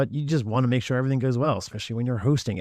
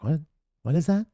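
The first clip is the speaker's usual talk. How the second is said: a higher, mildly baffled what; replaying her puzzled first reaction to the headline; light mock disbelief at a strange title; retold surprise; 6 semitones higher than usual. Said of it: "I saw that title and I was like".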